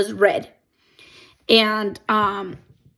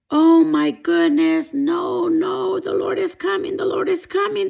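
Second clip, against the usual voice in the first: retold panic; 9 semitones higher, 4 dB louder than usual. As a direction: relive the dream fear, pleading. Retelling terror at dream trumpets.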